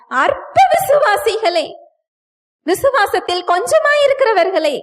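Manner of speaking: emphatic rebuke. Acted.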